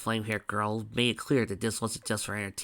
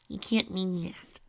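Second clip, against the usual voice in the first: high Pinky voice; 9 semitones higher, slower than usual. A narrator's reading.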